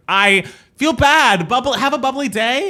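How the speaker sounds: high, slow, strained cheer; through gritted teeth. Retold or imitated. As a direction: force the sign-off through your teeth. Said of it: "through gritted teeth".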